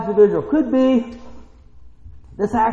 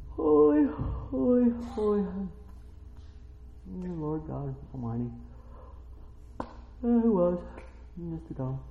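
quiet recital; 10 dB quieter than usual. Shown as a demonstration. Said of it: "What do you think they're doing?".

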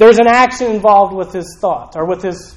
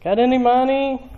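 begging man; 6 semitones higher than usual. Acted. Beggar asking temple passersby for money, pleading. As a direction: call out for spare change, pitched up. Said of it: "imagine he's sitting there and he's like".

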